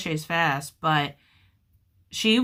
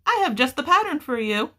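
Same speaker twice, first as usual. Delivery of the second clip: eager; 9 semitones higher, 3 dB louder than usual. Retold or imitated. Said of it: "And I'm like".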